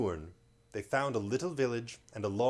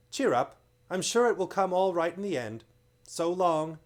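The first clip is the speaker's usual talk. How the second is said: higher, louder brave farewell; brave comfort; 7 semitones higher, 6 dB louder than usual. A narrator's reading. Caspian comforting Lucy as he is led off, brave. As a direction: cheer her up as you're led away.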